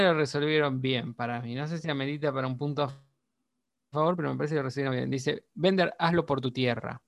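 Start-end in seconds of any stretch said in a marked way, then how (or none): none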